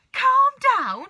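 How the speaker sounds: very high, very loud disbelieving shout; outraged cry. Acted. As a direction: explode at the very idea of calming down. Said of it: "cried Mister Cranky".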